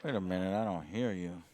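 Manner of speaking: ordinary talk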